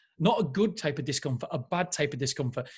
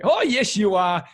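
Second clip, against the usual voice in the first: loud, high, gushing reply; an eager fan shouting back at the author; excited yes; 4 semitones higher, 7 dB louder, slower than usual. Retold or imitated. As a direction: cheer him on eagerly. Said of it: "And I'm like".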